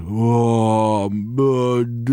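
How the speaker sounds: a lightly voiced slurred drunk; drunk brother. Imitating his drunk brother on the phone, lightly slurred. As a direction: mimic a wasted brother barely talking.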